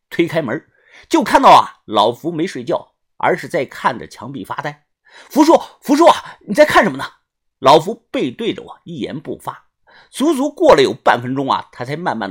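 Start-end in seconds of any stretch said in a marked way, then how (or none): 5.3-7.0 高声急切地叫人; 抬高嗓门喊着问. Acted. 高声呼唤福叔. (大声招呼长辈)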